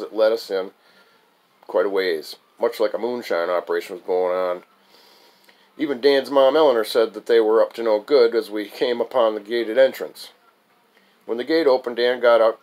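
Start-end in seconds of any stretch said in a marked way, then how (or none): none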